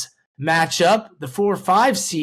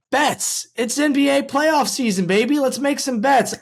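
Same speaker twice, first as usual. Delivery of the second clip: hyped ad read; 7 semitones higher than usual. Acted. Hyped sponsor read opener.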